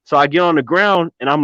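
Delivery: usual speaking voice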